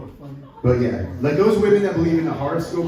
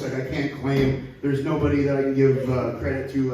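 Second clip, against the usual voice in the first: normal talking voice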